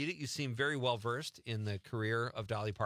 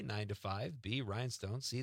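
ordinary talk, nothing marked

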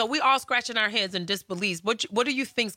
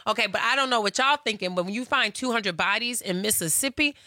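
normal talking voice

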